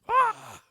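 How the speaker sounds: sudden high loud yelp; high exclamation. Real, not acted. Exclaims in amusement.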